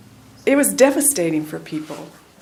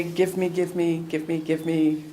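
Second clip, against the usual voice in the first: mock greedy begging; 3 semitones lower, 6 dB quieter than usual. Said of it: "sit in meditation and say".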